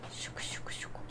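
breathy whispered chucu chucu; soft unvoiced murmur to himself; playful whisper. Real, not acted. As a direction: whisper it like a silly little sound. Whispered playful noise at game.

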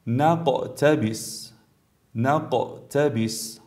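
slow نقتبس with a short bounce on ق; brief qalqala. Shown as a demonstration. Tajweed teacher gives second example, bouncing the ق in نقتبس. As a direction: release the ق of نقتبس briefly before the ت. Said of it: "حرکت بسیار مختصر".

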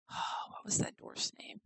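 whispered to herself. Real, not acted. Player whispering while trying to remember a dwarf's name. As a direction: breathe it to yourself without voice. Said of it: "whispering to herself".

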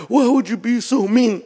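high-pitched imitation of an offended crowd; mock reproach. Everybody objecting to the strict dad, mock reproach. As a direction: mimic them complaining, high and plaintive. Mimics everybody's reaction to him.

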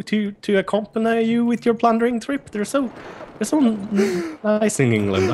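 put-on English accent. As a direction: serve politely in a mock English accent. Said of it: "I love your accent".

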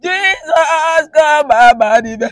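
loud, drawn-out wailing prayer; crying. Real, not acted. Woman begs the Lord to come down, wailing. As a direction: cry out to God at full voice. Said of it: "You have started again".

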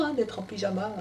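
ordinary delivery

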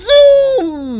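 high, loud gleeful zoom sound; excited zoom. Real, not acted. Cheering Huggy's fall, a high excited zoom. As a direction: call it out as he flies.

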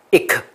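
"一克" as a short ik with a separately released k; sounds said separately. Shown as a demonstration. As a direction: pop the k on its own after the vowel in "一克". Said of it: "个别要练，都要练出来". (把“一克”两个音分开念)

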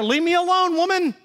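high, mock-grumpy "leave me alone"; mock grumpy husband. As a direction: play the selfish husband, half joking. Husband voicing selfish complaint.

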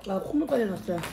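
speaking between bites; chewing while talking. Real, not acted. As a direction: mouth partly full of food. Seen 0: Bites at -1.0, lips pursed and moving, chopsticks holding food throughout.

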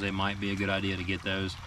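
ordinary talk

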